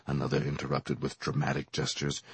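ordinary delivery, nothing marked

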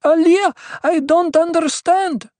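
high, loud anguished plea; distraught woman crying out; wailed. A narrator's reading. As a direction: wail it helplessly to the priestess. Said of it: "the woman wailed".

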